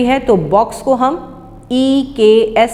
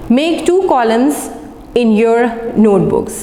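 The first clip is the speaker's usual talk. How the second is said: slow instruction; much slower than usual. Shown as a demonstration. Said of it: "अब यहाँ पर जो word आपको आया है कॉलम".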